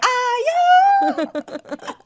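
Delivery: high loud "Ayu!" cry; Guyanese shout "Ayu". Retold or imitated. Her new stimming word, loud high "Ayu!". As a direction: blurt it as a stim word. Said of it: "everyone like".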